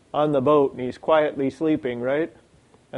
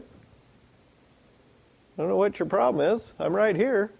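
higher-pitched, easygoing puzzlement; light, casual bafflement at the fuss; nonchalant Jesus; 5 semitones higher, 3 dB quieter, slower than usual. Acted. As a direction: act unbothered, voice lifted. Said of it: "He's like".

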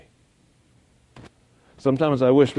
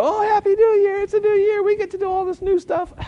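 much higher, chirpy mock celebration voice; mock cheerfulness; 5 dB louder than usual. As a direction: parody shallow holiday cheer. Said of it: "And not just, you know".

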